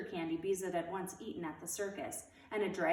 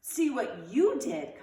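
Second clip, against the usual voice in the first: slow, loud, higher retort; contradicting; 5 semitones higher, 8 dB louder, much slower than usual. A narrator's reading. Beezus turning the blame on Wayne, indignant. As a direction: throw it back at him. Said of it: "contradicted Beezus".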